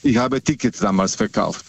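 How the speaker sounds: ordinary talk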